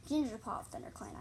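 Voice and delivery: plain reading voice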